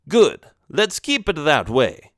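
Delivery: slow, menacing Italian don; slow Italian accent warning. Acted. Vincenzo warns the boy, slow Italian accent. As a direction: lay down the law in an Italian accent. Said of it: "thick Italian accent".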